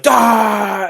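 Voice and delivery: loud, high drawn-out "Daah!"; jump-scare yell, playing the chestburster prank; sudden mock scream. Acting out schoolyard chestburster scare.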